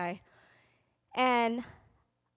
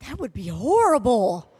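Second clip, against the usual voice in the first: mock horror; 9 dB louder than usual. Teen reacting to mom.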